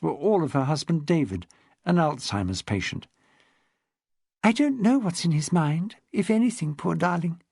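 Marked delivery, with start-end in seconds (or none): 4.4-7.4 put-on higher, refined elderly upper-class voice; posh old lady. Old lady tells Rose about her husband, posh elderly voice. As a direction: speak like a frail, well-bred widow of seventy. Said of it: "in an accent redolent of a privileged upbringing".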